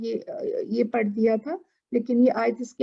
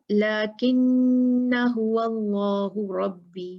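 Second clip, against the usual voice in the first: recitation; much slower than usual. Shown as a demonstration. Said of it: "reciting verse example".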